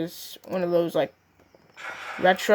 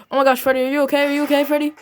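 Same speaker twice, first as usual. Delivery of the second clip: higher and louder, anxious calling; panicked worry; 6 semitones higher, 4 dB louder, much faster than usual. Acted. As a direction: call out to your fallen friend.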